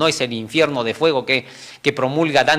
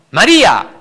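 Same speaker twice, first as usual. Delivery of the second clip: María said loud and strong, pitch raised; forceful call; 4 semitones higher, 8 dB louder than usual. Shown as a demonstration. Jesus calling María strongly to make her react. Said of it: "le habló con fuerza".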